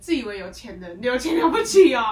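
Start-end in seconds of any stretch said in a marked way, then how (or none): 1.0-2.0 loud, high sarcastic jab. Real, not acted. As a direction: sneer at the rich loudly. Mocking arrogant rich customers.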